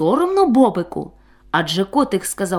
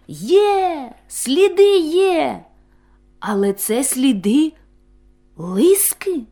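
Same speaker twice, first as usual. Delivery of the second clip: high, slow excited cry of discovery; 6 semitones higher, 3 dB louder, slower than usual. A narrator's reading. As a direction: call out the tracks, thrilled. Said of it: "схвильовано сказав". (схвильовано, високо й протяжно)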